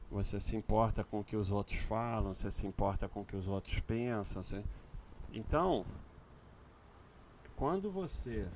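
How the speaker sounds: ordinary delivery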